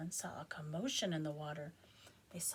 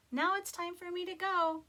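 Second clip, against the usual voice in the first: put-on high, louder girl voice; Jessica's voice; 11 semitones higher, 8 dB louder than usual. Jessica saying goodbye to the chicken. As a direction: speak it as young Jessica. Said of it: "said Jessica".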